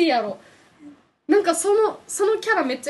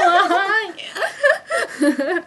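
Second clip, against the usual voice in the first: laughing; 7 semitones higher, 8 dB louder, much slower than usual. Pretend. Mock cheer, laughing.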